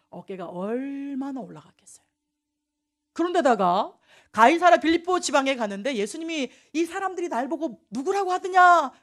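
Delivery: usual speaking voice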